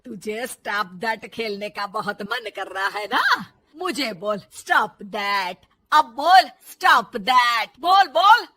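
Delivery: loud mother-in-law building to a shout; angry goading. Acted. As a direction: dare her, slow and emphatic, then bark it. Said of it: "फिर बहू पर गुस्सा आ जाता है".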